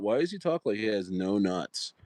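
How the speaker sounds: ordinary talk, nothing marked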